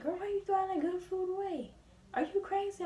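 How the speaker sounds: high, loud exasperated mom voice; mom scolding. Retold or imitated. Mom scolding her daughter for throwing food away, high and loud.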